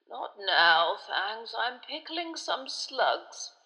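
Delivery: put-on tiny old wizard mumbling slowly in his sleep; drowsy muttered brush-off, very slow; sleepy mutter.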